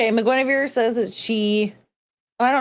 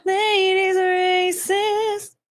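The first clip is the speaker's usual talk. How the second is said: Disney princess; 7 semitones higher, much slower than usual. Pretend. Composing the princess song.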